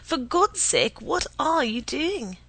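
high, loud, appalled exclamation; alarmed cry. A narrator's reading. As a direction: demand an answer in horror, stretching the question. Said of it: "he cried".

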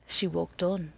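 ordinary delivery

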